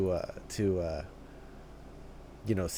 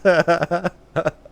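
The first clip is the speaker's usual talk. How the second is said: loud, high burst of laughter; excited laugh; 9 semitones higher, 12 dB louder than usual. Real, not acted. Reacting to a striking K-pop outfit, loud excited laugh.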